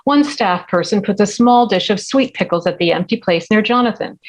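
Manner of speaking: ordinary talk, nothing marked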